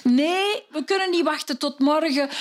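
high, insistent wife voice; wife refusing to wait until morning. Acted.

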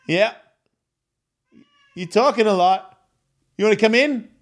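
high-pitched cooing to a cat; pet talk. Real, not acted. Podcaster answers his chatty cat, high pet talk.

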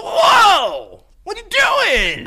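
high yelling whoa, slow; startled shout. Retold or imitated. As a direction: yell it in alarm. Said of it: "You were like".

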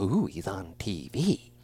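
low, hushed, slow mock awe at a TV face; mock awed viewer. Mocking starstruck people, hushed slow awe. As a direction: breathe it out like a starstruck fan.

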